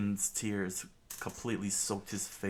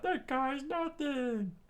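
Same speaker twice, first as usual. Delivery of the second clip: very high, slow cartoon pirate voice; slow, squeaky mockery of a beaten cook; slower than usual. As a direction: squeal it out as a tiny sneering pirate. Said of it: "The pirate screamed out".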